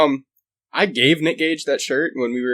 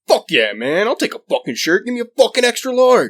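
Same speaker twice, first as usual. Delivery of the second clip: imitating Nick Gage; 8 semitones higher than usual. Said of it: "And he's like".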